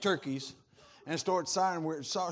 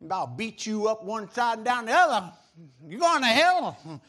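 Bible-thumping zealot; 3 semitones higher, 9 dB louder than usual. Retold or imitated. Preacher imitating zealots who condemned people, loud and threatening. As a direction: thunder it like a zealot beating them with scripture. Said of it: "tried to beat you to death with the Bible".

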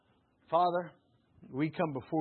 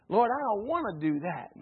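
loud, higher whiny protest to God; reluctant refusal; 5 semitones higher, 6 dB louder than usual. Acted. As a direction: complain like a stubborn child refusing. Preacher acts reluctant believer refusing.

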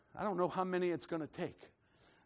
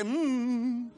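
long held hum; sustained worshipful "mm"; moan in the spirit; 7 semitones higher, 7 dB louder than usual. Shown as a demonstration. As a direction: hum it out for others to join. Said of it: "somebody say".

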